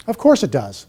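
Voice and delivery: normal talking voice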